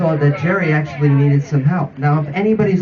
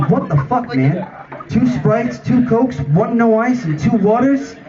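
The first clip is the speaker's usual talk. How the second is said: higher-pitched exasperated listing; retold yelling; 4 semitones higher, slower than usual. Jerry reads his scrawled drink order back to the helper, mildly yelling. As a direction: rattle off the order in mock exasperation. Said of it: "starts yelling and says everything".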